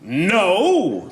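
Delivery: brief hammed-up hero cry; superhero refusal. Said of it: "A superhero being propositioned by a hooker".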